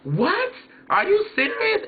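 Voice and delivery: high, incredulous what; rising mock astonishment; hearing the song was a cover, mock astonished; shocked disbelief. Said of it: "And I was like".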